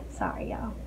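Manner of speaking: soft whispered sorry; barely voiced apology. Real, not acted. Apologizing after outburst.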